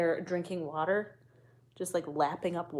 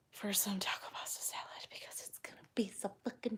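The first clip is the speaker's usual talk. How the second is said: whisper; 7 dB quieter than usual. Real, not acted. Whispered aside about dinner.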